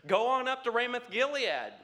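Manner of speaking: mock prophet voice. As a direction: lightly mimic the prophets. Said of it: "He says".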